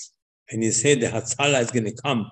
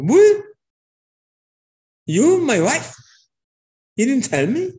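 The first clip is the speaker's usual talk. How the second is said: much higher, loud stunned cry; shocked outburst; 12 semitones higher, 6 dB louder than usual. Acted. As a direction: blurt it out, floored by the news. King shocked at Esther's secret.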